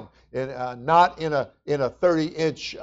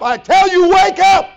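a very high, loud shout of wake up; shouted command; 12 dB louder than usual. Acted. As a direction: shout it right at them.

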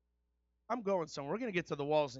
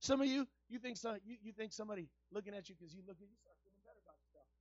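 loud, ringing preacher's cry; shouting sermon climax; full-force preaching; 7 semitones higher, 17 dB louder than usual. Acted. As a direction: preach it out to the whole room, full voice.

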